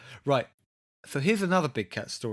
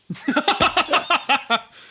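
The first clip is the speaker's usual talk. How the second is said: high, loud burst of laughter; 12 semitones higher, 7 dB louder than usual. Real, not acted. Host joking about cat and girlfriend, loud high laughing. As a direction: crack up at your own joke.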